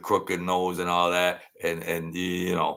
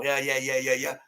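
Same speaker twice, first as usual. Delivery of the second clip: normal talking voice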